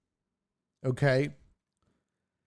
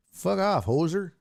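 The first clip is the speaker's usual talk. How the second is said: mock Canadian accent, higher and punchy; Canadian hoser voice; 5 semitones higher than usual. Host mocking the Minnesota players as Canadians, in a hammed-up Canadian accent. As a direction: bark it like a movie hoser. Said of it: "Strange Brew".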